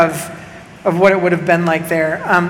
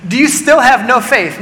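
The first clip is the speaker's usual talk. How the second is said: louder and higher, with STILL leaned on; pointed rebuke; 5 semitones higher, 4 dB louder than usual. Acted. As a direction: press the question on "still". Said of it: "Jesus looks at them and says".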